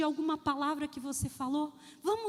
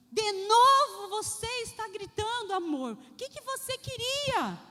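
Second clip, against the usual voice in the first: louder, higher exasperated wife; irritated scolding; 5 semitones higher, 4 dB louder than usual. Retold or imitated. Wife nags husband as he walks in, irritated. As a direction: scold him for yelling.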